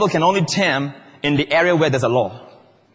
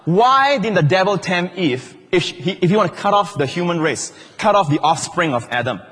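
usual speaking voice

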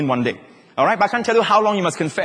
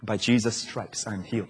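sense of love; 8 semitones lower, 9 dB quieter than usual. Shown as a demonstration. Preacher modelling confession, hushed with a sense of love. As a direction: dwell on it quietly, almost to yourself. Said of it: "when you say the sense of love".

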